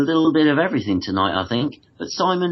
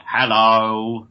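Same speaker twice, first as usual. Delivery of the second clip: drawn-out, put-on hello; demonstrated greeting; 2 semitones lower, 3 dB louder than usual. Shown as a demonstration. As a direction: stretch out a mock guest greeting. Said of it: "you say something like".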